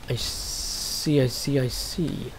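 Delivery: soft, half-whispered realisation; quiet to himself. Real, not acted. Gamer realising the way down, soft murmur. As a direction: murmur it as it dawns on you. Spotting the route down, muttering.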